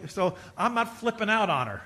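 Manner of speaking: ordinary delivery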